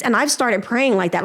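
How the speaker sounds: ordinary delivery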